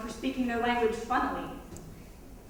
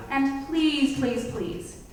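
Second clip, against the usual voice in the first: ordinary delivery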